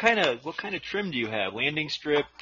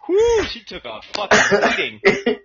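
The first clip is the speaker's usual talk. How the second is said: loud exclaiming; 6 semitones higher, 9 dB louder, slower than usual. Acted. Crude exclamation, loud and far.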